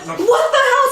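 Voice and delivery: high, loud, incredulous outburst; mock alarm. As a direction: yell it as a baffled worker, hammed-up. Workers react to runaway kid.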